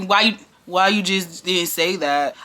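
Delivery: slow, low voice imitating a coworker; mock her with a deep, dragging delivery; low, halting imitation of her question; deep, mellow mimicry. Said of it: "Real deep and mellow".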